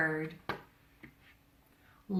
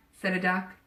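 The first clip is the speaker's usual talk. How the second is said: ordinary delivery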